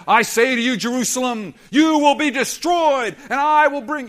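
loud, ringing prophet's pronouncement; booming pretend proclamation. Preacher imagining Jesus thundering judgment at Jerusalem, booming. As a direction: thunder the doom over Jerusalem. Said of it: "He could have stood and said".

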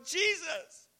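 high-pitched call of "Jesus"; hammed-up high cry; mock crying out. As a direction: imitate someone calling out to Jesus. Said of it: "cry out to Jesus".

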